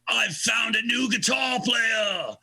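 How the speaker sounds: high, slow imitated rock-star shout. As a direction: proclaim it to the whole party below. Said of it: "looks down the [name]. He goes".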